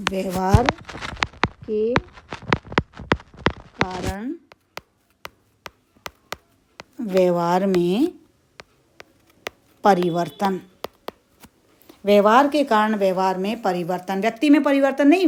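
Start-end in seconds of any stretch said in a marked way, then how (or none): 0.1-10.6 word by word with long pauses; slow dictation. Shown as a demonstration. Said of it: "dictating word by word". 14.2-15.3 quick, higher, louder correction; emphatic no. Shown as a demonstration.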